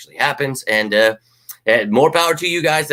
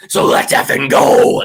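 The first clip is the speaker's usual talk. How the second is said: hype rallying cry; 7 dB louder, slower than usual. Real, not acted.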